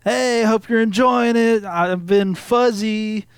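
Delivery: rock radio DJ. Retold or imitated. Imitating the local rock station guy signing off, cringe DJ style. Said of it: "the local rock station, the guy".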